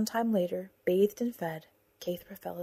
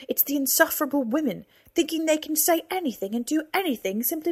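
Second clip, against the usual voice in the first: angry rant; 7 semitones higher, 4 dB louder than usual. Acted. Ranting about women.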